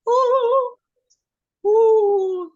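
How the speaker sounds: high, loud howling whine; dog crying. Retold or imitated. Woman imitating the isolated beagle crying, high howling whine. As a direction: wail like a lonely dog.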